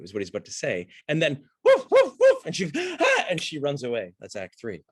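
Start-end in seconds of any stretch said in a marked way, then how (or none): 1.6-2.4 very high, loud woofs; imitated whooshing woofs. Mimics the climax of the scene. 2.8-3.2 high, loud startled "ah"; mock startled gasp. Acts her alarm before running away.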